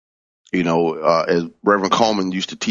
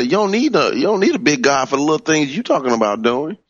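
high-pitched imitation of a teacher; Reverend Coleman; 7 semitones higher, faster than usual. Preacher imitating a mentor chiding small requests, pitched high. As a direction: pitch it up, quoting her admonishment. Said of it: "And she would say".

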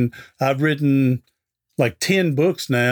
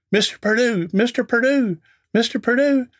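rising calls of Mister Perdue, higher each time; calling out; 8 semitones higher than usual. Retold or imitated. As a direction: call his name across the store. Guy calling across Walmart.

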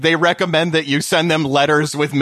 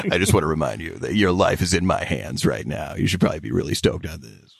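low, fast, self-important pilot voice; heroic airline pilot; 10 semitones lower, 4 dB quieter, faster than usual. Retold or imitated. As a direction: talk down like the hero of the flight. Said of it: "talking to you like they're a hero".

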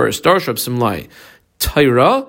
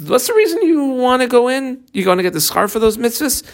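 high-pitched, probing question; raised, incredulous-style questioning pitch; acted questioning; 11 semitones higher than usual. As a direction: ask it high, as if challenging someone's reason. Voicing Hashem questioning Moshe.

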